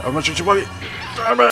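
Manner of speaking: high, loud frightened yell; panicked scream. Real, not acted. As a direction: shriek as she appears. Monster appears right beside.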